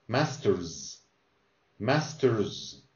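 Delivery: Masters ending in a voiced z; final z sound. Shown as a demonstration. Teacher drills the final z for the student. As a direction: buzz the z at the end of Masters. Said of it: "you're gonna say Z at the end".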